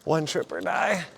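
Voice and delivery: loud and strained under heavy bags; straining, loud. Real, not acted. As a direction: push the words out while lifting. Still hauling the bags.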